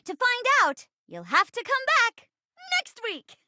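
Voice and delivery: high, theatrical sequel tease; teasing cliffhanger. Acted. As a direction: pitch it high like a showy announcer's cliffhanger.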